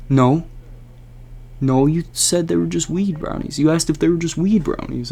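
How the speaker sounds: a mock-defensive Jared; defensive friend.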